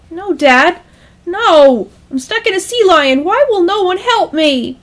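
high, loud, pleading whine; frustrated, panicky son's wail; nervous whine. Acted. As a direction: whine to dad in desperation. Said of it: "The giddiness faded back into a nervous whine".

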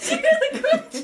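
high squeal through laughter; helpless, high-pitched real laughter; laughing hard. Real, not acted. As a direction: ask it while cracking up. Laughing fit after egg cracks.

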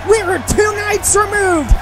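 booming wrestling commentary intro; ring announcer. Acted. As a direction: sell the main event to the crowd. Calls the match as announcer.

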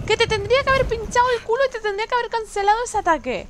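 very high, very loud indignant shout; outraged protest. Real, not acted. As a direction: yell at the unfair boss. Protests at the enemy. (protesta indignada a gritos)

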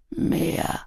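low-volume, husky mumble; murmured. A narrator's reading. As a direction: murmur it softly, barely voiced. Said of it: "murmelte er".

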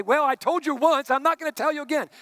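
hammed-up annoyed brush-off; impatient scolding. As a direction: toss it off like a fed-up parent. Mocking an impatient God.

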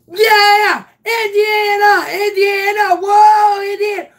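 yelling her name, high and loud; excited team cheering. Retold or imitated. Team screaming for Indiana in excitement. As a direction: cheer the hitter on at full volume.

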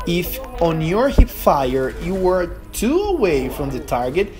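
usual speaking voice

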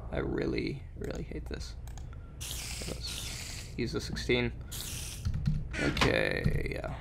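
breathy whispered grumble; whispering. Real, not acted. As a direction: whisper it under your breath. Whispering so as not to be heard.

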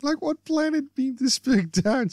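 high-pitched indignant squeak. Acted. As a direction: squeak it out in a high girlish Barbara voice. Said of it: "she squeaks".

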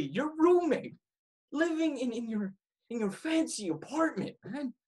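an angry friend venting, voice rising; heated confrontation. Acted. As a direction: let the frustration boil over into a rant. Roommate confronts Brian, growing heated.

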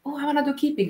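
hint of an eager beginner's voice; eager newcomer. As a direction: pitch it up a little, quoting a keen beginner. Voices an eager club player.

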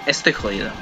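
usual speaking voice

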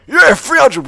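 big, high shout of triumph; sudden joyful whoop of victory; excited cheer. Real, not acted. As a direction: yell it out like you just won. Hits rank three hundred.

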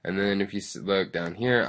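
ordinary delivery

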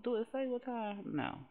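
normal talking voice

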